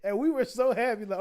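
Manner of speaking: normal talking voice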